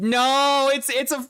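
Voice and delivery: sudden high, loud dismayed outcry. Real, not acted. Gamer reacting to his ruined playbook, high loud outcry. Star player stats turn out bad.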